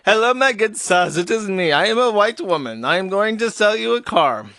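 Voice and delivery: mock Indian accent, hammed-up; fałszywy akcent indyjski. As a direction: parody a salesperson with a put-on Indian accent. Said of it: "doing a fake Indian accent".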